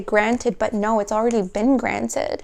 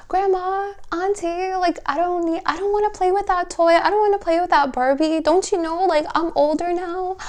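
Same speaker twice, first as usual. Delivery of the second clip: put-on high, fast little-kid voice, whiny; childish voice; 7 semitones higher than usual. Reader voicing the viewer as a child to grandma, high and whiny. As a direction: play a kid refusing old toys, higher and quicker.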